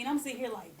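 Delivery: normal talking voice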